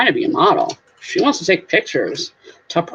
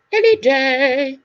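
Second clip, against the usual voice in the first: higher, drawn-out singsong; stretched, raised repeat; playful echo; 4 semitones higher, slower than usual. Pretend. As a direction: belt it out slowly like a jingle. Playful echo, much louder.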